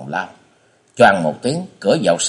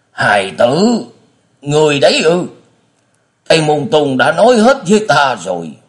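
put-on old prisoner, higher and louder, emotion rising; less cold; 6 semitones higher, 5 dB louder than usual. A narrator's reading. Blind father recognises his son, no longer cold. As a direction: let the coldness give way as you address your child. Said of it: "lúc này không lạnh lẽo như trước".

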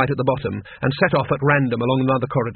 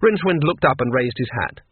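plain reading voice

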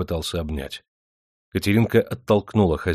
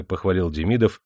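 ordinary delivery

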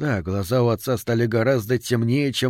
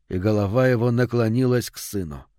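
plain reading voice

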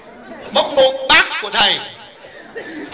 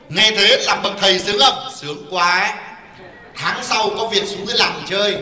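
ordinary talk

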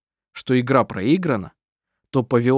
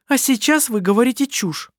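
indignant snap; 11 semitones higher than usual. A narrator's reading. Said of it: "оборвала его Керстин".

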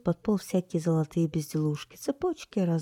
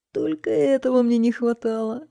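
higher, louder exasperated shout; 8 semitones higher, 8 dB louder than usual. A narrator's reading. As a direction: shout in dismay. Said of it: "крикнул бургомистр". (громкий раздражённый крик)

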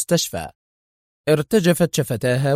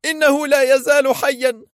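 very high and loud; woman refusing to accept death; stubborn shout; 13 semitones higher, 4 dB louder than usual. A narrator's reading. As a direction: insist defiantly. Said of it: "صرخت في عناد".

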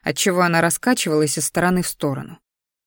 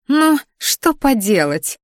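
put-on higher, slow, scornful snort of a spoiled girl; snorting dismissively; 7 semitones higher, 3 dB louder, slower than usual. A narrator's reading. Spoiled sister snorting at her brother, scornful. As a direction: drawl it out with a sniff. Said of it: "фыркнула Криштия".

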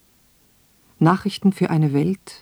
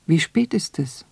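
startled. A narrator's reading. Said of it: "fragt sie erschrocken".

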